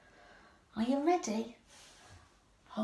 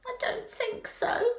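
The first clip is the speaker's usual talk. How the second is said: high-pitched put-on Rabbit voice; disguised Rabbit; 11 semitones higher than usual. A narrator's reading. Rabbit pretending to Pooh he isn't himself, disguised voice. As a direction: deny it in a much higher voice. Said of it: "in a different sort of voice".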